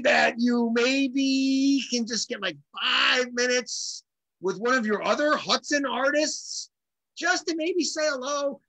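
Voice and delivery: high, drawn-out hopeful wheedle; coaxing plea. Retold or imitated. Drummer angling to meet his idol through a friend, coaxing. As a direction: stretch out the request, pitched high and pleading.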